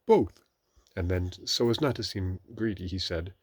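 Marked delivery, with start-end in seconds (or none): 0.0-0.4 high, loud excited blurt. A narrator's reading. Pooh offered honey or milk, excited. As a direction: blurt it eagerly. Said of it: "He was so excited".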